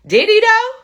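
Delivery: high, loud, disbelieving "did he though"; incredulous squeak. Real, not acted. Host doubting the father's claim to viewers, high incredulous squeak. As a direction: voice jumps up in sharp doubt.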